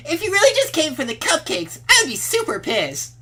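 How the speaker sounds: put-on feisty girl voice.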